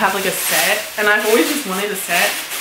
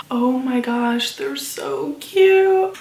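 high, stretched-out squeal of delight; excited gushing; 5 semitones higher, much slower than usual. Real, not acted. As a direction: gush over the cute headphones. Unboxing new headphones, gushing.

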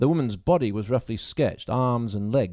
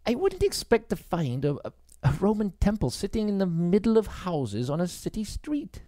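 higher, halting woman's voice; Grace, hesitant; 9 semitones higher than usual. Acted. Grace wondering at the temple, higher and hesitant. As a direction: stumble over the words, surprised.

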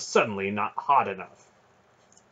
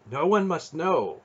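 higher, urgent warning; pressing caution, raised pitch; 8 semitones higher, 4 dB louder than usual. A narrator's reading. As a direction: warn urgently of a secret. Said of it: "her voice warned inside his head".

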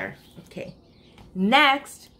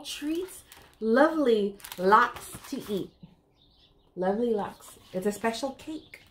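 put-on reading to children; 5 semitones higher than usual. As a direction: read it like a bedtime picture book for little ones. Reads rhyming picture book aloud.

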